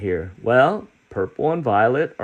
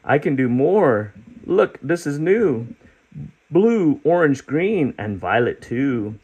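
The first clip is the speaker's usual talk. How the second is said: proud creature; 4 semitones higher than usual. Acted. Creature showing the children his rainbow, proud and high. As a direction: boast about every colour.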